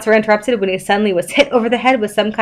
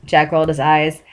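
ordinary delivery, nothing marked

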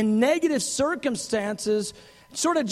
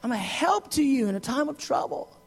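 eager reassurance; 6 semitones higher than usual. Retold or imitated. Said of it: "He goes".

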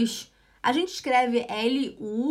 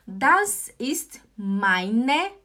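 very slow, separated words, louder; slow model phrase; 5 dB louder, much slower than usual. Shown as a demonstration. Teacher alerting learners to "meine", slow and emphatic. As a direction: spell it out word by word.

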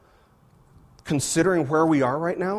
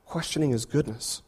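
ordinary delivery

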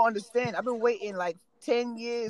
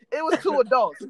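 ordinary talk, nothing marked

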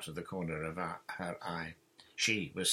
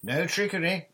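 high, loud warning; shouted; 10 semitones higher, 9 dB louder than usual. A narrator's reading. John shouting a warning to the pirates, loud. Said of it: "shouted John".

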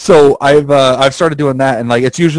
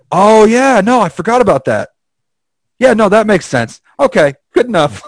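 high-pitched giving-in voice; mock easily convinced; 8 semitones higher than usual. Skeptical friend conceding about Fantastic Four, mock easily convinced. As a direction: imitate a doubter folding instantly.

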